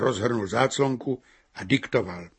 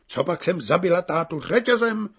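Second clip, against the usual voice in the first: indignant cry, pitched higher; exclaiming barmaid; 6 semitones higher, 3 dB louder than usual. A narrator's reading. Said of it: "zvolala paní výčepní".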